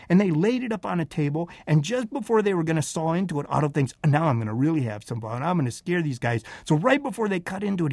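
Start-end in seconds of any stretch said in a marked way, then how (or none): 4.0-6.4 low-pitched plotting to himself; sly scheming. Acted. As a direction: lower the voice, hatching a prank. Otto plotting his prank inside moose.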